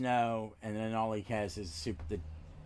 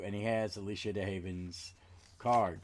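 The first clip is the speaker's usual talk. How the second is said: usual speaking voice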